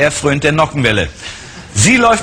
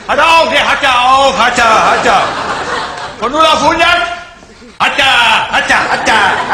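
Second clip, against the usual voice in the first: proll car buyer; 9 semitones higher than usual. Acted. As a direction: gush over the new car like a show-off buyer. Same buyer character throughout.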